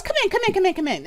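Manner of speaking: high, eager welcome; hurrying guests inside; jolly hostess. Acted. Jolly halfling woman eagerly ushering guests inside, high voice. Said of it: "loud and boisterous and jolly".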